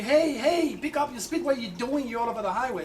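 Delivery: a wife yelling at her swerving husband, in alarm; retold screaming. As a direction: shout at the driver to speed up. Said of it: "my wife's screaming at me".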